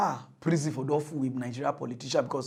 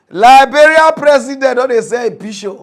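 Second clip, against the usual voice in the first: excited headline; 8 semitones higher, 17 dB louder than usual. Acted. Announcing mock headline.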